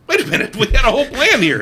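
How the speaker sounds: high, laughing protest of betrayal; exasperated disbelief. Retold or imitated. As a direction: squeak out the shock through a laugh. Retold disbelief at friend's backout.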